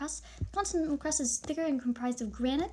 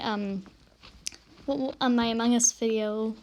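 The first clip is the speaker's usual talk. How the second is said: ordinary talk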